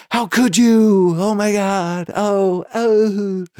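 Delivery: hammed-up wail. Screaming at his girlfriend outside the casino, high hammed-up wail. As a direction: wail it like a betrayed lover. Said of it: "yelling and screaming on the phone".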